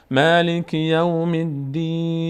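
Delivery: slow, sustained tajweed chant; Quran recitation. Shown as a demonstration. Teacher models the verse for learners, slow measured recitation. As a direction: recite slowly, drawing out the long vowels.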